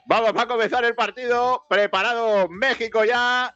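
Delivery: high-pitched, drawn-out football announcer delivery; play-by-play commentary. Real, not acted. Commentator readies listeners for Mexico's match, high play-by-play. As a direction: call the kick-off like a match commentator.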